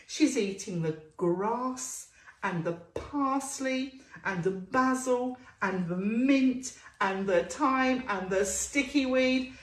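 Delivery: ordinary delivery, nothing marked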